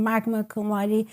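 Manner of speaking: ordinary delivery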